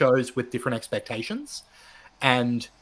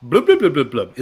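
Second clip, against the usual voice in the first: playful louder blubbing gurgle; underwater blub noise; 8 dB louder than usual. Pretend. Joking after underwater mic remark.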